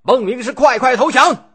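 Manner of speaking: a high, loud battle cry at the enemy general; loud shout. A narrator's reading. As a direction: yell it across the hillside, demanding surrender. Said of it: "大声叫喊着".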